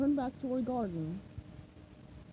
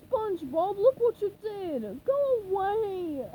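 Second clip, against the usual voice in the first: higher, louder, slow furious scolding; angry Squidward; 7 semitones higher, 5 dB louder, slower than usual. Acted. Squidward scolding SpongeBob over his ruined painting, angry. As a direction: drive him off in anger.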